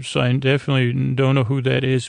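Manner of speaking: ordinary talk, nothing marked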